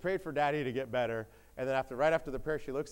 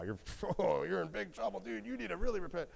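higher-pitched, hammed-up tut-tutting; mock alarmed warning; 8 semitones higher than usual. Job's friends warn he is in big trouble, mock alarm. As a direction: warn with comic dread.

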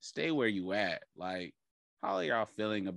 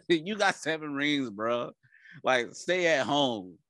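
ordinary delivery

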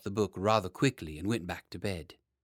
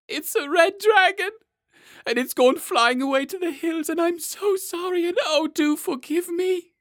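much higher, loud, wailing through tears; in floods of tears; 9 dB louder than usual. A narrator's reading. Boy king confessing to his ministers, wailing in tears. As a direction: sob it out like a small boy. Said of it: "in floods of tears".

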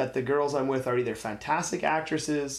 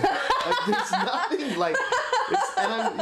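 laughing; slower than usual. Real, not acted. Laughing at own joke.

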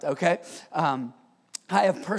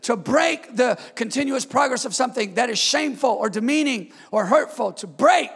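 higher, louder, hammering repetition; preaching at full force; 5 semitones higher, 8 dB louder than usual. Acted. Pastor preaches divine interruption to the church at full force. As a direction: drive each "To break" home.

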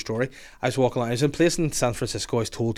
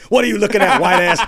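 laughing through a louder, higher mock heckle; 7 semitones higher, 8 dB louder than usual. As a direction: bark it like the street guy while cracking up.

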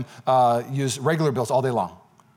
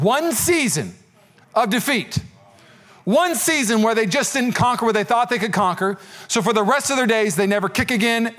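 raised, driving preacher voice; preaching at full force; 5 semitones higher than usual. Acted. Preaching over the elephant lesson.

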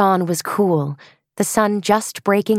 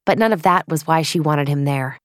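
usual speaking voice

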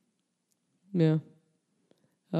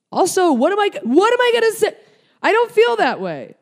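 much higher and louder, sarcastic squeal; mocking high voice; 11 dB louder than usual. Mocking a fan's request to talk about beauty, squealing. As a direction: squeal it like a vain airhead.

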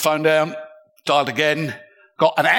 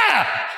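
sharp higher cry of fright; startled shout; 5 semitones higher than usual. Retold or imitated. Woken by staring strangers, startled higher yelp. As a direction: jump awake with a yelp. Woken by faces inches away.

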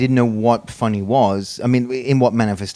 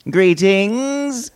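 high, drawn-out theatrical "Greetings"; grand greeting; 10 semitones higher than usual. Pretend. Co-host opening the show with a grand drawn-out greeting. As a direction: announce yourself like a herald.

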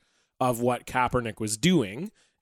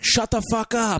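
higher-pitched mimicked "shut the fuck up"; clear heckle imitation; 9 semitones higher than usual. Co-host mimicking the player's mic'd-up outburst, clear heckle imitation. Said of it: "it was so clear".

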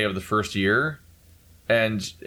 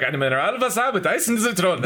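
mock German accent; 9 semitones higher than usual. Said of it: "everyone else is like".

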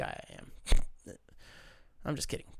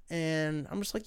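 usual speaking voice

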